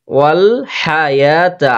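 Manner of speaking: ওয়াল হায়াতা slow, with "yaa" stretched; long-drawn vowel. Shown as a demonstration. Teacher modelling for learners, stretched "yaa" in ওয়াল হায়াতা. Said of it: "এক আলিফ টানবো".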